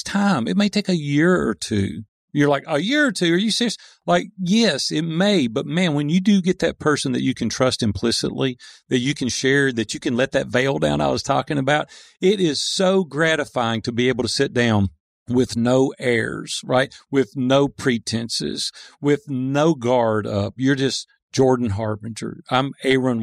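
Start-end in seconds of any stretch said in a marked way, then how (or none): 2.7-3.8 incredulous. Retold or imitated. Imagined listener balking at timeline. 15.3-20.5 each phrase drawn out slowly for weight; spaced-out emphatic list; slow and deliberate. Real, not acted.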